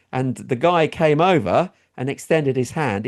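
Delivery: ordinary talk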